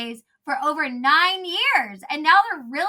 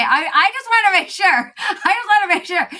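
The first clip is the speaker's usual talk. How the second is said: playful loud; 7 semitones higher, 9 dB louder than usual. Real, not acted. Joking about cussing.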